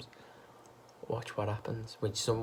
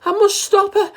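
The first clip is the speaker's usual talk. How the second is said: high, loud wail; 13 dB louder than usual. A narrator's reading. Missus Cranky wailing over Grandma, high and loud. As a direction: wail in despair. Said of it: "Missus Cranky wailed".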